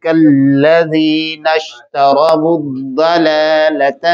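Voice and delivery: slow, chanted tajweed recitation; Quran recitation. Shown as a demonstration. Teacher recites the full verse for the class. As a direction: intone the verse slowly. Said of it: "اب یہاں سے پھر repeat کریں گے".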